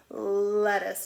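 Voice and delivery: put-on "Lettuce" said loud and clear for kids; teacher modeling "Lettuce" with a clear l; modeling a word. Shown as a demonstration. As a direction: pronounce "Lettuce" slowly, leaning on the l. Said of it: "Do you hear the l sound in lettuce".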